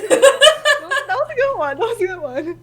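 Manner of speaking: high laughing praise through giggles. Real, not acted. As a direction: crack up while saying it. Laughing at own joke.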